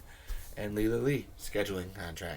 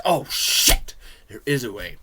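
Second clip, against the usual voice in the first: excited discovery; 11 dB louder than usual. Retold or imitated. Retold moment of discovery.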